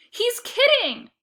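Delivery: slow, high, loud forced denial; tense, through teeth; hissed. A narrator's reading. Lexi cuts off her brother to Shelby, tense and strained. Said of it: "she hissed".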